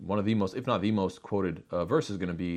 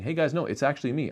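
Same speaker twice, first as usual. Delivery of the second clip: lightly acted, higher-pitched protest; insisting healed man; 7 semitones higher, 3 dB louder, faster than usual. Healed man tells doubting neighbours who he is, higher insistent voice. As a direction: speak up as the man correcting everyone.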